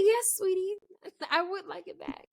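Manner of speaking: high, coaxing voice, softening at the end; sweet-talking a child. Retold or imitated. Answering the child sweetly, retold.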